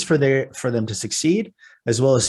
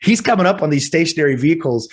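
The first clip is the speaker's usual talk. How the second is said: usual speaking voice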